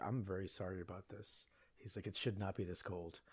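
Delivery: soft, low mumbling apology; apologetic driver. Retold or imitated. Uber driver apologizing softly to the visitor. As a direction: apologize quietly for the weather.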